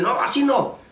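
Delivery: high, urgent warning without extra volume; alarmed parent. Acted. Parent stopping child from socket.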